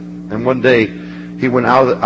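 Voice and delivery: normal talking voice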